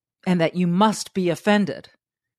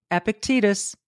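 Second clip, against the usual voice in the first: ordinary talk, nothing marked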